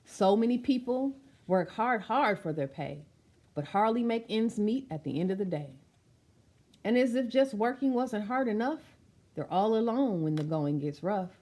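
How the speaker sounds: ordinary delivery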